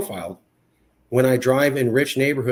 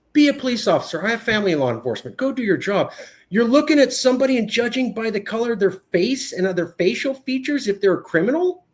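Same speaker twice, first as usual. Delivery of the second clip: raised, higher-pitched outrage; indignant rant; 10 semitones higher than usual. Real, not acted. Speaker ranting about profiling, higher indignant voice. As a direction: let the anger climb.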